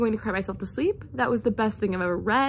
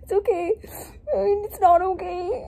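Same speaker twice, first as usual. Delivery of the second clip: 1 teary; 5 semitones higher than usual. Real, not acted. Still teary after twist.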